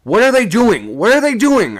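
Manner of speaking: very high, loud panicked call; a panicked sailor calling into the dark; frantic cry. A narrator's reading. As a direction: shout up in fright. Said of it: "I sung out".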